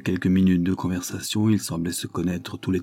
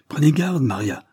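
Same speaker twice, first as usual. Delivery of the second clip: high, loud cry of alarm; terrified cry; 7 semitones higher, 5 dB louder than usual. A narrator's reading. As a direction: shout a frightened warning. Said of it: "cria Miss Bordillion épouvantée". (cri épouvanté, plus aigu et plus fort)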